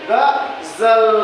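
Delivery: गजल very slow, with a long ringing Z for ज; buzzing Z. Shown as a demonstration. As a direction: split गजल and draw out the buzzing Z. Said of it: "झंकार आ रहा है".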